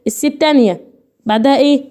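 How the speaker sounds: normal talking voice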